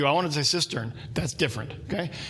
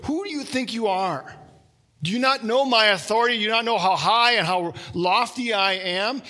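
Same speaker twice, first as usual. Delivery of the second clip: a haughty, raised voice with a rising challenge; angry king's retort; 6 semitones higher than usual. Retold or imitated. A proud king snapping at the priests, half-acted anger. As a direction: lift the pitch and demand respect. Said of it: "And he says".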